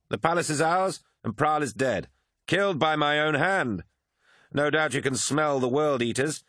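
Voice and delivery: high-pitched, cocky battle report; swaggering swordsman Lucius, boastful. Acted. Swordsman reports the captured palace to his comrade, boastful. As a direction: boast of your kills in a lifted voice.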